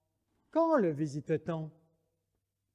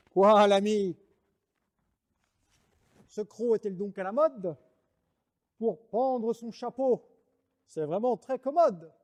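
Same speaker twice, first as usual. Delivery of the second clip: cavalier; 3 semitones higher, 3 dB louder than usual. Acted.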